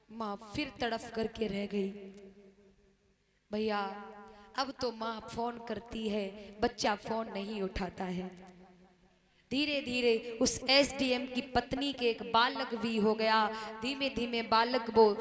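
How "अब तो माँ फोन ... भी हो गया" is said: usual speaking voice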